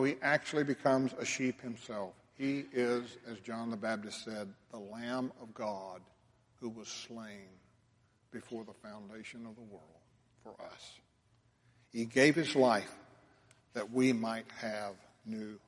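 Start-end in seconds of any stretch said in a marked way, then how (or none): 4.7-11.0 reverent hush. Real, not acted. Reverent fading phrase.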